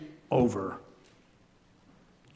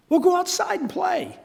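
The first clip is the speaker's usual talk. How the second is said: much higher, patient parent suggestion; 12 semitones higher than usual. Retold or imitated. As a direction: play the worn-out parent. Parent answering a bored child.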